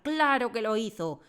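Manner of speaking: loud, high booming insistence; loud booming headmistress; thundering voice. Acted. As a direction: thunder it down at her. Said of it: "dijo con voz tonante".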